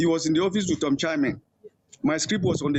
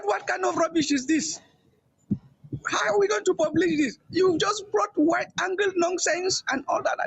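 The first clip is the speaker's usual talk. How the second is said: retold angry scolding; 14 semitones higher than usual. Boss scolding over bad photos.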